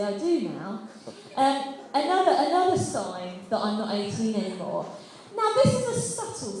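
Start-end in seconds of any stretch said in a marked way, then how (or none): none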